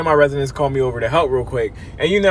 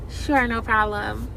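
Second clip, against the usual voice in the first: higher, much softer, slow and sugary; sweet accommodating; 6 semitones higher, 6 dB quieter, slower than usual. Retold or imitated. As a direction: soften it into a sweet, obliging reply. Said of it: "Well, I was like".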